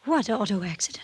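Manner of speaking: stunned, breathless whisper; barely voicing it in shock. Real, not acted. Shocked to hear of accident.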